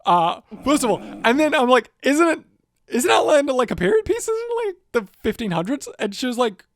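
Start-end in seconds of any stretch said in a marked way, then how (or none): 2.0-5.9 high, disbelieving questions; acted-out confusion, pitched right up; baffled questioning. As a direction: query it in rising bewilderment. Retelling puzzled questions to mum.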